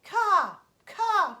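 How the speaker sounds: two high, loud bird caws; raven caws. A narrator's reading. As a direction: caw twice like a raven. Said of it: "cried the raven".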